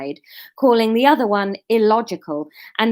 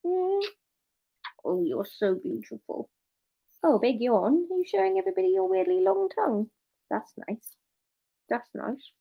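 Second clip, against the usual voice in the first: soft cooing; 4 semitones higher, 6 dB quieter than usual. Real, not acted. Cooing at the dog.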